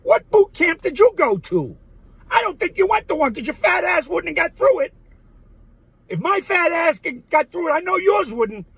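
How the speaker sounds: high, loud, indignant tirade; pissed off. Real, not acted. Challenging an admiral about boot camp, angry and loud. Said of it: "That pisses me off more than anything".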